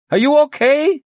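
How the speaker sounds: high, loud shouted question; shouting. Acted. Chief checking on the inspector over crackle, shouting. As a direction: shout to check on the other person.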